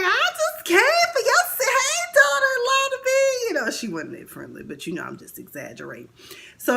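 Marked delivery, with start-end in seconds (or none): 0.0-3.5 very high sugary put-on voice; mock sweet mother-in-law. Mother-in-law greeting the aunt in a high sugary voice. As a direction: sing it high and falsely sweet. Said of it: "She was like".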